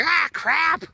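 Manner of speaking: high-pitched, mimicked exclamation; imitated dismay. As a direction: squeal it, impersonating someone else. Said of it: "Heidi's like".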